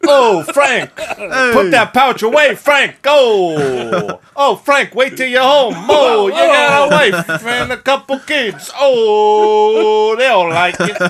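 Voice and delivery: high, loud Italian-American wiseguy heckling; exaggerated Italian-American accent. Ribbing Frank over his wife and kids, broad Italian-American accent. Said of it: "read the rest of the script like that".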